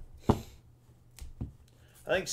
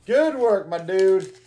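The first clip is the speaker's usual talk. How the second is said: loud, raised-pitch congratulations; hearty praise; 2 semitones higher, 7 dB louder than usual. Real, not acted. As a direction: cheer on a buddy. Cheering a viewer's good result.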